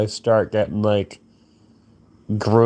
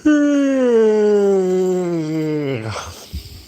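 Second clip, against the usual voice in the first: high, drawn-out whiny sigh; 8 semitones higher than usual. Real, not acted. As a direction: let out a long moaning sigh. Tired, turkeyed out.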